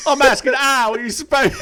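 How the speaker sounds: very high wailing British butler; hammed-up high plea dissolving in laughter; mock butler impression. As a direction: squeal it in exasperation, cracking up. Butler impression continues, breaking into laughter.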